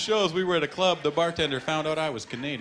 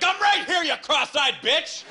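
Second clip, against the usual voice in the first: much higher, louder shout of frustration; snapped shout; 4 dB louder than usual. Retold or imitated. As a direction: yell it like a kid finally losing it. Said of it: "I just snapped".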